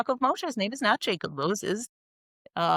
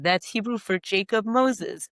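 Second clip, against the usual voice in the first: ordinary talk, nothing marked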